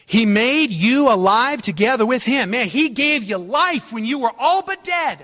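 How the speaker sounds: high, loud exultant proclamation; full-force preaching. Real, not acted. Preacher proclaiming new life to believers, at full force. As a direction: shout the good news to the room.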